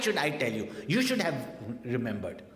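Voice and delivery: loud, high scolding reproach; scolding wife. Retold or imitated. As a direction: snap it at him like an exasperated wife. Wife scolding forgetful husband.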